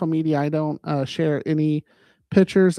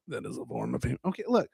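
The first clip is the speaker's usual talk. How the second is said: ordinary delivery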